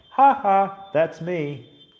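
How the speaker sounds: high, loud teasing spirit; taunting ghost voice. Pretend. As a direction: cackle it out like a playful ghost. Voicing the ghost taunting him.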